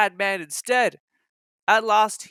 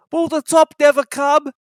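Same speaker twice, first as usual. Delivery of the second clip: straining; 10 semitones higher, 6 dB louder than usual. Acted. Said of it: "he thought".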